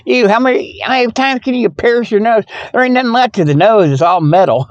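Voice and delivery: ordinary delivery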